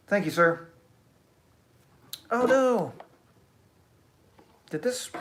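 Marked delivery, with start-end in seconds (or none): 2.3-5.1 loud, high-pitched cry of dismay; real dismay. Real, not acted. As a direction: exclaim as the stream suddenly fails. Stream suddenly breaks down.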